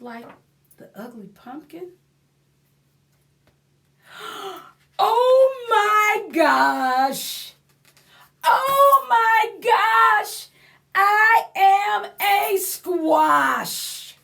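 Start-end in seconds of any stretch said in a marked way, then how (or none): 4.1-4.6 gasp. Acted. Pumpkin discovers truth. 5.0-14.2 loud, high, slow joyful cry; thrilled. Acted.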